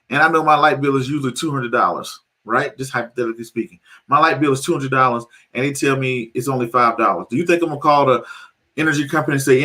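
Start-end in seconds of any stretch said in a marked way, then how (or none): none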